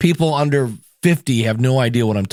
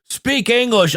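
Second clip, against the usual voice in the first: higher, slow, put-on gruff rock star; 8 semitones higher, slower than usual. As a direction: bark it like a grumpy rock legend. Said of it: "in the way that [name] is like".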